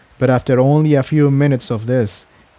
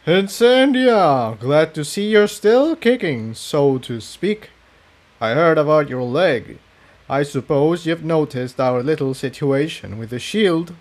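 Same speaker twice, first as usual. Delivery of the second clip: villain Rubidium; 4 semitones higher than usual. Acted. Villain taunting Incendia over the shield, smug and sneering.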